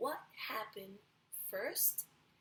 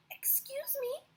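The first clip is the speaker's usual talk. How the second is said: much higher, small polite voice; polite cricket; 5 dB louder than usual. Acted. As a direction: clear your throat like a tiny insect. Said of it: "coughed the cricket".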